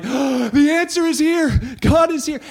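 high, loud, slow triumphant cry; jubilant Israelites. Retold or imitated. Israelites greeting the ark, loud triumphant joy. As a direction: announce salvation with joy.

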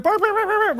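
high, quick yaps of a small terrier; mock yapping dog; yappy dog imitation. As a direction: bark it out like a frantic Jack Russell.

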